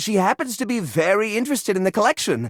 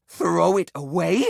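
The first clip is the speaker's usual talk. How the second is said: usual speaking voice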